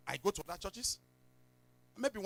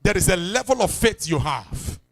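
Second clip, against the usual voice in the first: louder declaration to a neighbor; loud call and response; 14 dB louder than usual. Shown as a demonstration. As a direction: proclaim it loudly for the congregation to repeat. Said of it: "Tap your, stop somebody, say".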